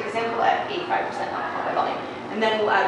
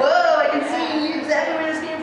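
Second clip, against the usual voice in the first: amazed; 9 semitones higher, 4 dB louder than usual. Retold or imitated. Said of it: "And then you're like".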